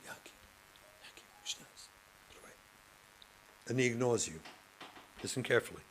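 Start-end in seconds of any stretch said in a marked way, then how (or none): 0.0-2.5 soft unvoiced whisper of his name. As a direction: breathe it gently so only he hears. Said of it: "And you whisper to him".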